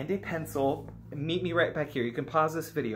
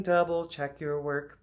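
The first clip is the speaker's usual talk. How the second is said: ordinary delivery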